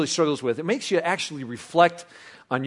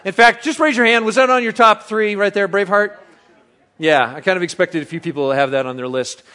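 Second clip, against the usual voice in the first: ordinary talk, nothing marked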